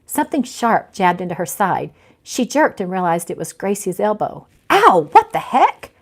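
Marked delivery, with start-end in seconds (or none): 4.7-5.9 sudden high yelp of pain and surprise; startled ow, high and loud. Acted. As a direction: jump at the jab and yelp. Jabbed by elbow, startled cry.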